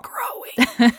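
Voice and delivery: eerie whisper, like a creeping menace; spooky whisper. Pretend. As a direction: breathe it out like a horror trailer.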